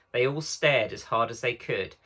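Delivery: ordinary delivery, nothing marked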